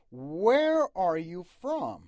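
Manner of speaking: slow teacher demo, "where" loud and "from" leaned on; stress on where and from. Shown as a demonstration. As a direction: hit "where" and "from" hard, slowly. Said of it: "where و from باید تاکید بشه".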